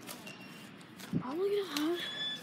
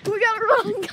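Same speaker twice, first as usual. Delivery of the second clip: shouting; 7 semitones higher, 7 dB louder than usual. Real, not acted. Urging to run.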